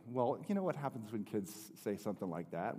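normal talking voice

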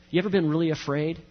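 ordinary talk, nothing marked

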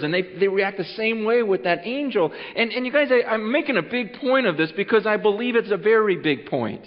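ordinary talk

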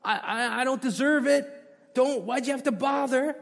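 high, loud gushing protest; mock fake modesty. Preacher mimics churchgoers brushing off God's gifts, gushing. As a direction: overdo the embarrassed "you shouldn't have". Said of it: "do we say this".

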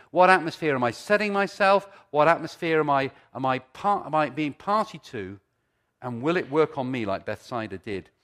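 loud, driving preacher voice; preaching. Acted. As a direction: build it up like a preacher. Preaching at full force.

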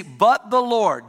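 loud slow stress on "but the Lord"; emphatic, slow. Shown as a demonstration. Pastor repeating the verse, loud and slow. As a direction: lean on each word of "but the Lord". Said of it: "You see".

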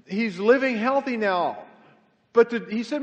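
full-force preaching, loud and high. Real, not acted. Pastor telling the congregation about a survivor, preaching. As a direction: preach it out to the crowd.

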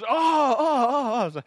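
loud, high dawning "oh"; mock realization. Officer learning the driver is a pastor, in mock surprise. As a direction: imitate a big sudden realization.

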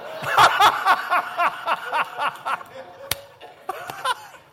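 long high laughing fit; loud laugh. Real, not acted. Pastor cracking up at his own story of Rita. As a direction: burst out laughing. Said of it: "You did too".

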